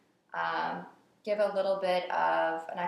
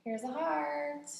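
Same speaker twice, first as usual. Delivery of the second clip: sweet high voice; 8 semitones higher, slower than usual. Real, not acted. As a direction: coo it gently and sweetly. Making a heart for sponsor.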